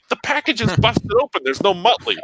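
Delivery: mock-outraged customer haggling; retold protest. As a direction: argue it, pitch jumping up. Retold haggling over damaged package.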